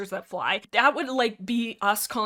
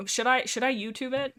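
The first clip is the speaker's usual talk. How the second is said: normal talking voice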